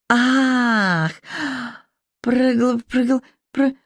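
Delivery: high sudden cry breaking off mid-word; startled outburst. A narrator's reading. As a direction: blurt it out and choke on the last word. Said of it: "вырвалось у миссис Дженнингс". (высокий внезапный выкрик, обрывающийся на полуслове)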